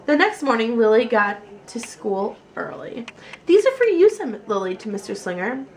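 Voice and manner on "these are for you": high, loud eager offer; eager child. A narrator's reading. Lily giving snacks to her teacher, high and eager. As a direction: hold out the gift with excitement.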